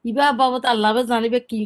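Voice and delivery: usual speaking voice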